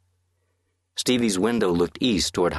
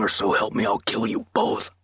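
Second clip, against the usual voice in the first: menacing threat; 3 semitones higher than usual. A narrator's reading. Masked man threatening both brothers, menacing threat. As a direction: lean in and threaten both boys. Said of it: "The man in the mask whispered".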